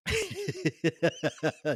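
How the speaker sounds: real amused laugh; short real laugh. Real, not acted. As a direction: burst out laughing at the story. Teller laughs at the memory.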